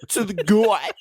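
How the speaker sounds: exaggerated, high-pitched drawn-out Australian accent; mock Australian accent. Podcaster imitating French hosts attempting Aussie, mock Australian accent. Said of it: "French podcast trying to do an Australian accent".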